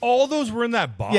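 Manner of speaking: high-pitched, real disbelief; incredulous squeal of a question; astonished question. Real, not acted. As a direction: blurt it out way up high, amazed. Astonished at where games came from.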